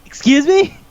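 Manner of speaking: high-pitched, rising "Excuse me?"; mock disbelief. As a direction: react as if scandalized, voice shooting up. Acted shocked reaction to movie line.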